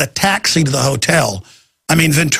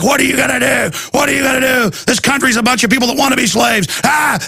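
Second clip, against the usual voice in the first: angry shout; 7 semitones higher than usual. Retold or imitated. Friend yelling at him in a taxi, furious shout. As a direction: yell it out like a man losing his temper. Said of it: "And he's like".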